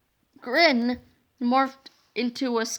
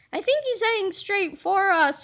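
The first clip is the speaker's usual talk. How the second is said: worried cry; 7 semitones higher than usual. Acted. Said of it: "cried yesterday George".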